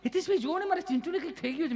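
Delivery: high, fast panicked plea; frantic high-pitched parent voice; panicked mother. Retold or imitated. Panicked mother rushes with child.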